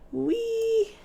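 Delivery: high, gleeful squeal of fun; high delighted whee. Real, not acted. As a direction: squeal it like you're on a slide. Sliding down, playful cheer.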